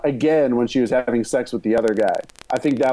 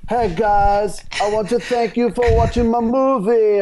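higher, odd foreign-sounding director voice; Tommy Wiseau impression; 8 semitones higher than usual. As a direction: thank the fans like a strange auteur. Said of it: "I just nailed that character".

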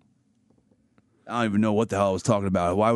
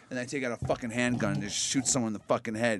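ordinary talk

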